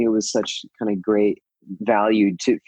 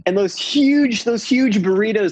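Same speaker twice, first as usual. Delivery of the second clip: excited gushing; 11 semitones higher, slower than usual. Real, not acted. Gushing about burritos.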